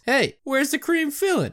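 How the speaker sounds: high, squeaky put-on shark voice; quoting the shark catchphrase. Podcaster quoting the famous commercial line in a high put-on voice. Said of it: "famous for the catchphrase".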